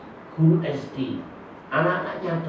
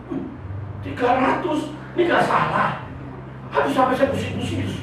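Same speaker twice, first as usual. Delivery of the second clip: high, fast disbelieving cry; shocked student; 11 semitones higher than usual. Retold or imitated. A student reacting in shock to a three-hundred-item exam, surprised. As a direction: reel at the huge number.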